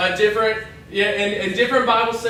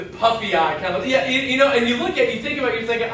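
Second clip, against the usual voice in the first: usual speaking voice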